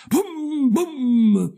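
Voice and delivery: high-pitched drawn-out booms; exploding firework. Acted. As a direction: imitate a Roman candle going off. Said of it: "Esplose la candela romana".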